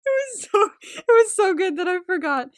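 high laughing words. Real, not acted. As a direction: laugh through the line. Laughing at forgetting the plot.

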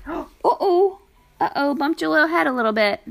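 sudden gasp, then soothe the little bump; surprised uh-oh. Real, not acted. Baby bumps her head.

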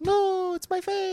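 very high put-on whine; fake girl insisting. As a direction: protest in a high girlish fake voice. Insisting the mask is a face.

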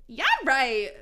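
loud mock exclamation; exclaimed. Friend doubting the denial, exclaiming. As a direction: exclaim it with extra punch. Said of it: "she exclaimed".

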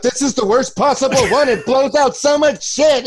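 high, lightly sung robotic assistant mockery; a touch of a sing-song search engine; mock Google voice. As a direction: lift the pitch and half-sing it like a smug gadget. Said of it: "Google throws up".

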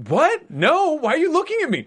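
high, loud guilty protest; flustered panic. Retold or imitated. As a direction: crack under the stare. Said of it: "I'd fall apart".

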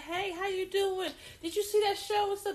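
a sing-song friendly hi; casual cheerful greeting. Retold or imitated. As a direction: keep it light and friendly. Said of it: "You don't have to say it like that".

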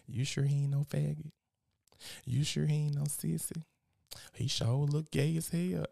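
lightly voiced nosy mama; mother quizzing her son about his friend; imitating his mama. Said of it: "this is how my mama was".

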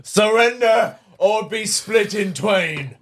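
slow, high, mock-menacing monster decree; hell beast voice. Hell beast threatening the heroes, slow and hammed-up. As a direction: declaim like a hammed-up doom demon.

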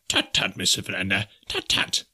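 prim, cautioning tut-tut. A narrator's reading. Professor cautioning his companion, mildly reproving. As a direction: caution him gently in a professor's voice. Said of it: "cautioned Professor Porter".

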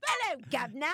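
put-on British accent with rising hello; a bad British accent, high and a bit loud. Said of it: "I'm still working on my accents".

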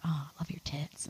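hushed, breathy pillow talk; whispered compliments. Pretend. Girl whispering compliments to her guy, barely voiced. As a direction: whisper it like sweet nothings.

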